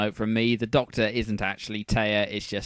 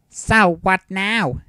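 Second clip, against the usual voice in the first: put-on slow worried girl voice; 8 semitones higher, 8 dB louder, much slower than usual. A narrator's reading. Serenity voice.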